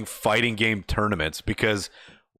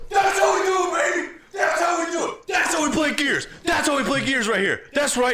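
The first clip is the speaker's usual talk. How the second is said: hype yell. Retold or imitated. Gamer yelling at the other team, hype celebration. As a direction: scream it across the table in triumph.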